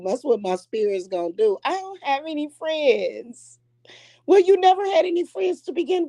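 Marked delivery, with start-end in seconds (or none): 1.6-3.6 slow, high whimper of a lonely witch; mock a rival whining pathetically; whiny rival. 4.3-6.1 high, loud mocking "dear"; sneering taunt. Taunting the rival.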